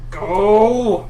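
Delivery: loud growled command; growled shout. Acted. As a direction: bark it out like a beast. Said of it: "growls out".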